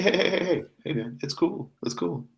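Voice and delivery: high-pitched, placating hey-hey-hey; high, softened calm-down voice. Acted. Bartender placating an offended customer.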